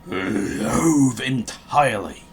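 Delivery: slow, relished mock Grinch line; slow hammed-up loathing. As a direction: savour it like a pantomime villain. Grinch rant, drawn out.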